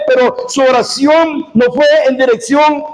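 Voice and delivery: ordinary talk, nothing marked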